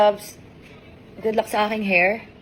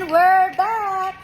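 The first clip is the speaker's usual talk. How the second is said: drawn-out, high welcome back; sing-song announcement; 6 semitones higher, 3 dB louder, much slower than usual. Pretend. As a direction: stretch it out high like a show host. Drawn-out call back to camera.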